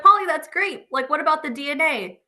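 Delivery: high, loud, thrilled reply; excited friend. Retold or imitated. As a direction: gush over the news. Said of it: "then she's like".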